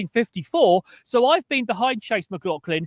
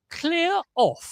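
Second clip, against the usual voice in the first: higher, drawn-out English dismissal; best English accent; 6 semitones higher, 4 dB quieter than usual. Acted. Caller telling complaining fans to leave, English accent. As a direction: send them packing in crisp English. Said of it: "in my best English accent".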